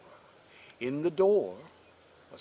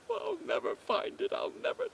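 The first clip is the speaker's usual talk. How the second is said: high-pitched acted sobbing wail; despairing girl in tears, voice pitched way up; crying. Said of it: "she began to cry".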